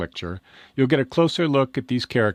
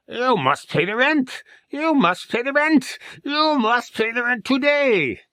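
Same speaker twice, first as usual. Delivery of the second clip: high, louder, slow villain demand; 13 semitones higher, 4 dB louder, slower than usual. Acted. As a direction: press harder for the rent, louder. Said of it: "the villain".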